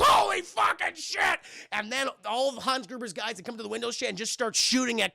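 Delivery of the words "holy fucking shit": high, loud, slow cry of shock; panicked shout. Retold or imitated. Guard sees a body fall on his car, shocked shout. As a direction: yell it as the body hits the car. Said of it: "He goes".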